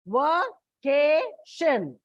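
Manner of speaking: vacation said very slowly with the stress on ca, va-CA-tion; stress on second-last syllable. Shown as a demonstration. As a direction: draw out vacation, leaning on the CA. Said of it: "from the end second".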